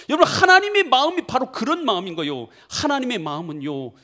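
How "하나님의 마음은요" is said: ordinary delivery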